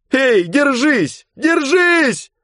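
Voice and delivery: very high, loud, slow calls; frantic shout. Acted. Girl on the shore shouts to the drowning man. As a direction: shout to him across the waves. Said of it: "кричала девушка".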